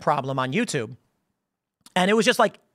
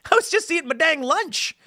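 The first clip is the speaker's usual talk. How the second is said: much higher, exasperated quote of a man who was only eating lunch; exasperated friend; 9 semitones higher than usual. Retold or imitated. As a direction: toss it up high, baffled and fed up. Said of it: "And he's like".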